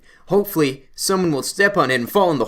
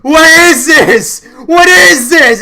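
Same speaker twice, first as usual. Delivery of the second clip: high, loud yelling as if stuck in the mud; panicked scream; 11 semitones higher, 14 dB louder, much slower than usual. Retold or imitated. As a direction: scream it out like the bully sinking in mud. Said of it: "he just starts screaming".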